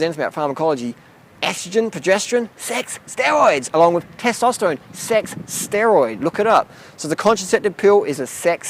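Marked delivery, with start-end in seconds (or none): 2.6-3.7 "sex steroids" slow and high, each word hammered; emphatic stress. Real, not acted. Setting doubters straight on pharmacology, "sex steroids" said slowly and emphatically. 4.9-6.2 "sex steroid" very slow, higher, word by word; emphatic stress. Real, not acted. Driving the point home to doubters, "sex steroid" drawn out emphatically. Hammering the term against doubters.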